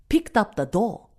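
ordinary delivery, nothing marked

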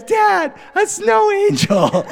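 put-on a thrilled toddler yelling from the snow pile; toddler delight.

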